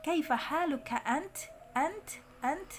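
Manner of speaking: "ant" with final t released in air; breath-released t. Shown as a demonstration. Teacher demonstrates ta at sukun, aspirated release. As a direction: puff out the t in "ant", high-pitched. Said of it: "taknya itu dihamesnya keluar".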